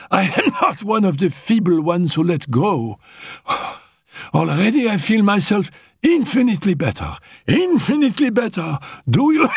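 high, breathless invalid's voice; a dying girl insisting she'll live; feverish sick girl. Acted. Dying Annette tells Raoul she will live, high and feverish.

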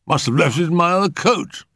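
high-pitched gentleman reasoning aloud; high, formal uncle voice; puzzled uncle. A narrator's reading. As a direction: pitch it up, working out where it went. Uncle searching for his key.

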